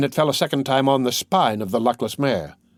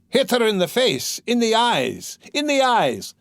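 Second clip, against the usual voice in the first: high, cruel urging cry; rising with each repeat; savage cry; 9 semitones higher than usual. A narrator's reading. Mikolka urging the lads to strike the eyes, savage cry. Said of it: "cried Mikolka".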